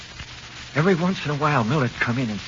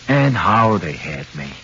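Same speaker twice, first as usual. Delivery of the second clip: bitter emphasis; 7 dB louder, slower than usual. Acted. Bitter narration of being convicted.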